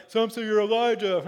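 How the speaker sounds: high, loud, silly skit voice with fake laughter; overacted jolly mock disciple; hammy bad acting. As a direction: shout it like a bad sketch actor, then fake a laugh. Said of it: "it's really silly and just bad acting".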